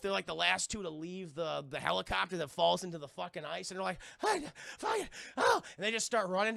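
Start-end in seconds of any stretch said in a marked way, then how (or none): 4.2-5.6 high panicked mock flight; hammed-up panic. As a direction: bolt in a panic. Voicing fleeing characters.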